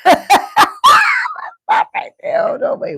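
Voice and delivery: very high breathless laughing squeal. Real, not acted. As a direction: squeak the words out mid-laugh.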